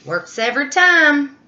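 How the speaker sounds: proud little mouse boasting; smug mouse. Acted. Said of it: "Mouse said".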